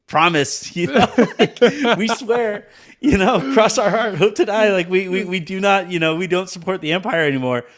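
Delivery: high, loud, laughing sarcastic pledge; mock sincere promise. Former fascists swearing loyalty, mock sincere and laughing. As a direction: sell the fake promise with a laugh. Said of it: "and it's like".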